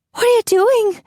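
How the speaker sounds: very high, loud panicked shout; frightened wife shouting. A narrator's reading. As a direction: shriek at the officer in alarm. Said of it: "Kelsey shouted".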